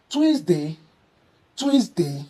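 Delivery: "Tuesday" as twis-day; mispronounced Tuesday. Shown as a demonstration. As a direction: say "Tuesday" with a tw and short i. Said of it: "please don't say Tuesday".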